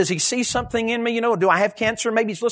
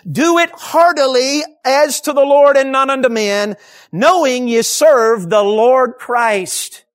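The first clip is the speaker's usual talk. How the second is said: louder, weighty, drawn-out verse reading; scripture proclamation; 3 semitones higher, 5 dB louder, slower than usual. Real, not acted. Preacher reading Colossians to the congregation, forceful proclamation.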